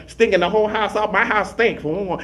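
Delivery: normal talking voice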